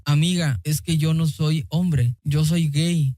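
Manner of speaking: ordinary talk, nothing marked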